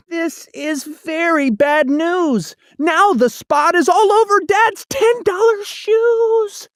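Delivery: put-on a child dismayed at ruined shoes; worried boy. A narrator's reading. Boy voice in Seuss read-aloud.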